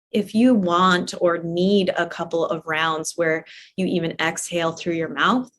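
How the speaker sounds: ordinary talk, nothing marked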